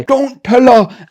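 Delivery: high, loud, slow desperate yell; panting shout. Retold or imitated. Dav's brother shouting breathlessly at the boys as he flees. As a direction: blurt it out breathless while running past. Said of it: "in the middle of panting and slobbering".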